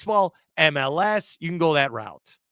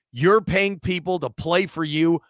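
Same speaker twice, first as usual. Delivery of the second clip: normal talking voice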